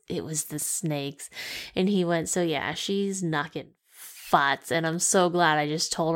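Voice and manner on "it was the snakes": slow, much softer, fed-up flat delivery; eye-roll deadpan. Retold or imitated. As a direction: say it like rolling your eyes. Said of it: "hugest eye roll, and it came out as".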